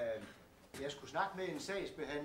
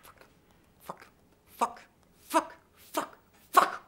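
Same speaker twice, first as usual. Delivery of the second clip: ordinary talk, nothing marked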